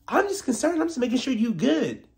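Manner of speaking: high, sugary fake worry; mock fake concern. Mocking Gizelle pretending concern, high and sugary. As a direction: sound sweetly insincere.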